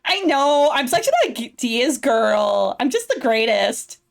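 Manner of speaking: put-on bubbly Valley Girl assistant. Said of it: "doing that Valley Girl voice".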